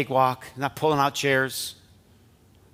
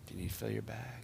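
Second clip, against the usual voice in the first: much softer, gentle echo of the offer; soft repeat; 3 semitones lower, 13 dB quieter than usual. Acted. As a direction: let it fall to a hush. Echoed softly as invitation.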